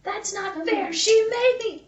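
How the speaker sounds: high sulky whine; mimicking the audio in a high whine; whiny audio voice. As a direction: whinge like a child caught out. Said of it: "that audio was like".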